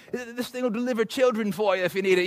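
high, over-the-top British accent impression; mock British designer promising miracles; Johnny Ive British accent. Preacher mocks Apple designer's British accent hype. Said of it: "he's got this great accent".

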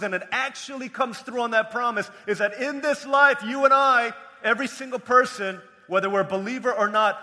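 loud, high pulpit delivery; emphatic preaching. Real, not acted. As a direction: build to a ringing declaration. Preaches the world's one promise.

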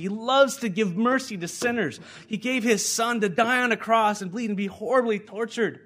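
high, loud gospel proclamation; impassioned preaching. Real, not acted. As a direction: build it with fervent force. Preaches the cross at full force.

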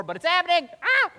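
high, loud build into a yelp; astonished shout breaking into a yelp; overwhelmed outburst. Retold or imitated. Excited retelling of a vision.

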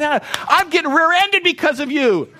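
high, loud frustrated outburst; angry inner rant. Retold or imitated. Pastor fuming at the driver who'll get him rear-ended, loud and high. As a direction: blow up at the driver ahead. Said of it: "And I'm thinking".

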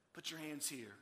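soft, low, calm offer; gentle invitation. Acted. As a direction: invite him tenderly and quietly. Jesus meets Thomas gently.

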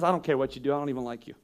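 low, soft and detached; quiet, heartless parent brushing off the kid; cold dismissal. Retold or imitated. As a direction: let it drop, cold and uninterested. Voicing uncaring parent to kid.

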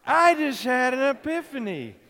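loud, high, joyful exclamation; excited. Shown as a demonstration. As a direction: cry it out together with glee. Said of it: "do it with me".

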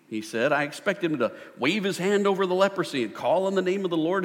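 high, loud indignant complaint; angry Naaman. Acted. Naaman, snubbed by the prophet, ranting loud and high. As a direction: rant like a proud general who feels snubbed. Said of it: "Naaman became angry and stalked away".

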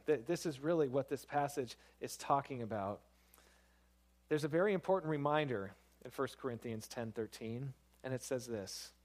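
ordinary delivery

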